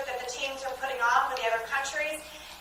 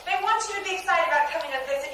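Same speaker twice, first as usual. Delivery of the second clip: normal talking voice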